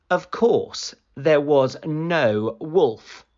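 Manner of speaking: usual speaking voice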